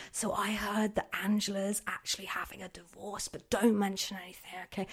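whispered rumour by the photocopier; conspiratorial whisper to a coworker; gossip whisper. Acted. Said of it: "by the photocopy going".

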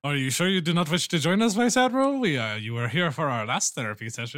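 politely pressing, in character. Acted. Player speaks in character.

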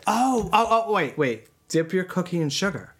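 tipsy and giggling; a little tipsy, laughing through it. Real, not acted. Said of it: "we're a little tipsy".

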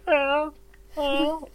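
small high mewling ow, imitating a cat; lightly mimicked cat's ow; cat cry. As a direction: squeak it like a hurt cat. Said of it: "I heard, like".

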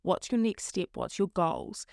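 ordinary talk, nothing marked

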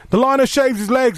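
high mock announcer call; imitating a heckler's high shout. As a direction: bellow it like a bored man in the stands. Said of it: "every five minutes he goes".

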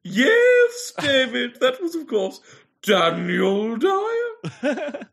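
high, loud gleeful reveal; triumphant announcement. Acted. Critic confirming David's answer, triumphant. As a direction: crow out the answer.

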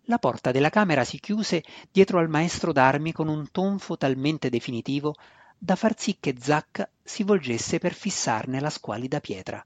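plain reading voice

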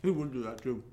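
talking while chewing chips; mouth full. Real, not acted. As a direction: say it around a mouthful. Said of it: "you're chewing away on your potato chips".

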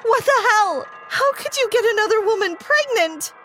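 high furious shrieking; shrill jealous scream at her teacher. Acted. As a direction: scream it at him in outrage. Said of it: "I got up and screamed".

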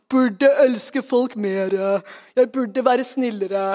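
very slow, drawn-out guilty moan; mock self-reproach. Believer scolding themselves for not loving enough, mock guilty. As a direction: drag each word out in hammed-up guilt.

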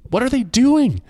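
put-on a scared kid calling out in panic, pitched high; high, shaken voice. Scared boy repeats his question.

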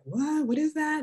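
high puzzled mimicry of people; mock confusion. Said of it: "and they're like".

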